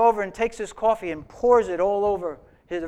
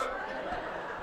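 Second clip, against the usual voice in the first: soft laugh; 17 dB quieter than usual. Real, not acted. Laughs at own joke.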